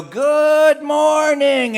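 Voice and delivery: stretched-out sing-song "Good morning", high and loud; cheery good morning. Retold or imitated. Camp counselor waking up happy, a drawn-out sing-song greeting. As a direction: sing out a slow, bright "Good morning" like the sun just rose. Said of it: "he'd go".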